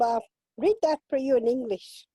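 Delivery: usual speaking voice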